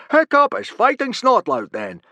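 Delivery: very loud, high hearty teacher; booming Gobber. Acted. Gobber announcing the fight to the boys, booming.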